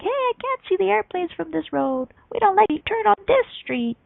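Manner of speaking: mock whiny kids bossing from the back seat; a hint of bratty kids shouting orders; bossy kids yelling. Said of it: "they start yelling at you".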